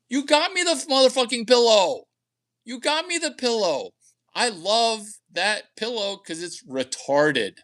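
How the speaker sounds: high-pitched incredulous outburst, building to a yell on "motherfucking"; mock-outraged complaint to the ex-girlfriend; exasperated rant. Ranting at ex over pillow.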